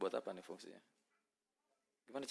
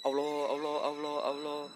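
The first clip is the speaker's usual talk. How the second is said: panicked; 5 semitones higher, 7 dB louder than usual. Real, not acted. Panicked exclamation while playing.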